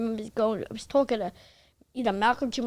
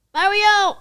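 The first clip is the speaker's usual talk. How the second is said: loud, high-pitched imitated summons; calling out; 9 semitones higher, 9 dB louder than usual. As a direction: holler the name across a studio. Cosby calling him across the studio.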